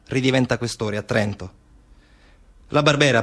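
ordinary delivery

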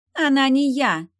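sharp high cry of protest; exclaiming. A narrator's reading. Real marquise protesting to the stranger. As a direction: blurt it out loud. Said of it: "подала голос настоящая маркиза".

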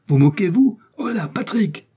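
high-pitched outrage turning into a call; indignant calling out. A narrator's reading. Buckingham refuses Felton and calls Patrick, indignant calling out. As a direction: summon help in a high, urgent voice.

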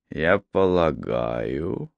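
deep, slow and careful male voice; low cautious man. A narrator's reading. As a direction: speak slowly and warily, low.

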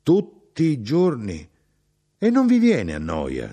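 the mother can't believe her ears; mother stunned at her son's everyday meals, exclaiming; baffled exclamation. Acted. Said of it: "Mia madre era sconcertata".

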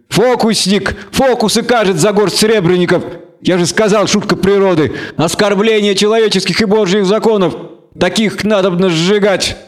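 high-pitched, scornful tirade; indignant bigot, pitch held high; hateful half-elf. A narrator's reading. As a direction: denounce the sorcerer with contempt.

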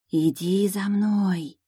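slow drawn-out eerie murmur; таинственный шелест. A narrator's reading. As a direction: stretch it like a rustling spell. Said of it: "Таинственно протяжный шелест". (таинственно протяжный шелест)

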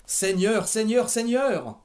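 loud, high howl, drawn out slowly. A narrator's reading. Mother howling to the Lord at the ceiling, loud drawn-out cry. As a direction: cry out to the heavens, building. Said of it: "hurla vers le plafond".

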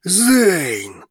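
yelling. A narrator's reading. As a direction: roar the name across the room. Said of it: "заорал дракон".

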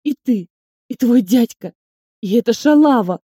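loud tearful accusation; enraged boss roar. A narrator's reading. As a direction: snarl it at all of them. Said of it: "рычит Мегера".

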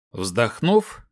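ordinary delivery, nothing marked

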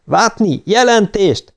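high-pitched commander's demand. A narrator's reading. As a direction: demand a report over the radio. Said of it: "követelte Louise". (magas hangú, követelő parancs)

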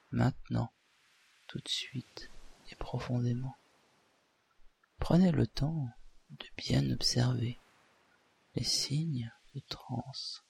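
soft, calm hypnotist induction; hypnosis voice. Acted. Leads a hypnosis session.

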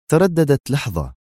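usual speaking voice

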